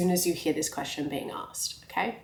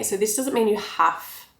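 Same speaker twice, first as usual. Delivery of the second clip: ordinary talk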